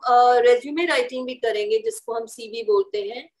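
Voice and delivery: ordinary talk, nothing marked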